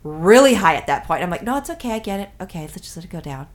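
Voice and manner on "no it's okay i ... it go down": acting out talking a drunk down; calming him down. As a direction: hush it gently, getting softer to the end. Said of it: "trying to get him to calm down".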